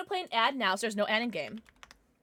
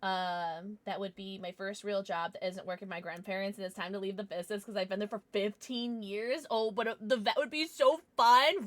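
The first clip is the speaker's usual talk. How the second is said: ordinary talk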